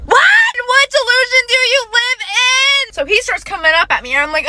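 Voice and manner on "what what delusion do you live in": outraged shout. Real, not acted. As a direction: yell it in total disbelief. Outraged at his line.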